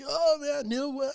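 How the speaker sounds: high, breathless mock amazement; mocking ghost hunter. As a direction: ham up the bro's disbelief, pitched high. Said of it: "And they're like".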